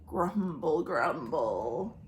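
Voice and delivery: low soft grumbling belly noise; belly rumble. Acted. Belly sound effect.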